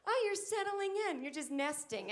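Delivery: gushing people. Retold or imitated. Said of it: "They're like".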